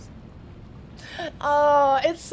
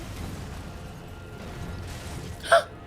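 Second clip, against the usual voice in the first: sharp gasp; 5 dB quieter than usual. Real, not acted. Nervous at boss arena hole.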